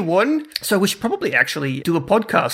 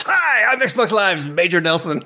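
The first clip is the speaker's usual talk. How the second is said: Major Nelson. Retold or imitated. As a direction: turn it up to ten like a radio host. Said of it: "the Major Nelson voice comes out".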